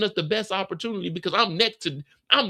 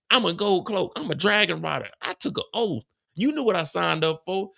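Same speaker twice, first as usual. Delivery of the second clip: ordinary delivery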